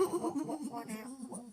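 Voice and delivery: sudden high flustered what; jolted out of daydream; startled. Acted. Miner spooked mid-sentence.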